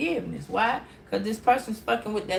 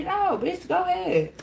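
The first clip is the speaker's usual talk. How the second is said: dismissive; 9 semitones higher than usual. Retold or imitated. Brushing the person off.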